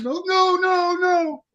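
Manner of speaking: high pleading mock cry; hammed-up tearful pleading, pitched high; panicked girlfriend. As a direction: beg him to stop like the scared girlfriend. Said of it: "His girlfriend jumps in".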